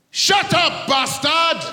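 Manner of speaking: high furious mock mum shout; loud high hammed-up mum; mum yelling. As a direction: bark it at the kid like an angry mother. Mum snapping at child.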